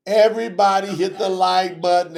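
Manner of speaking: rally the room on the first call. Acted.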